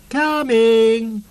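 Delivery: high, loud call of "Coming!". A narrator's reading. Sniff calls out to start the search, loud and high. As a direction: sing out to the hiders.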